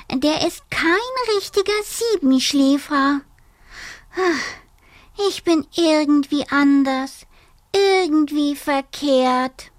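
high, small dormouse voice, a bit forlorn; a tiny creature feeling out of place; little dormouse. Acted. Dormouse tells the bat he feels wrong, high and small.

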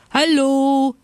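high, loud drawn-out hello; shouting from afar. A narrator's reading. Bears galloping over the snow greet friends, shouting. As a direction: holler a greeting across a field. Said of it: "schrien sie schon von weitem".

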